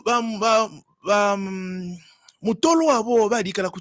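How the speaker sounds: ordinary talk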